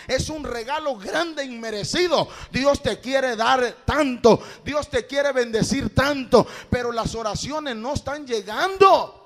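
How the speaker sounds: loud, emphatic preaching; prédica a toda fuerza. Real, not acted. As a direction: preach it out at full volume, driving each phrase home. (prédica enérgica a voz en cuello)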